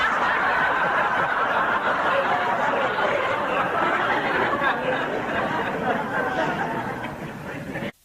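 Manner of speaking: a long, high, unvoiced laugh; long breathless laugh. Real, not acted. Speaker laughing long at his own Belfast joke, breathless. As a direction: give a long, wheezing fit of laughter.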